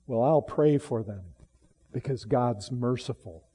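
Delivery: a lightly voiced meek Daniel, faded and soft; mocking soft Daniel. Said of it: "in whoever's caricature of this".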